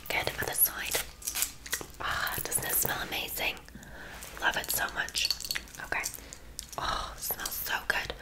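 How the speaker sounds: soft, breath-only whisper; ASMR whisper. Acted. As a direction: whisper it close to the client. Whispered ASMR salon roleplay.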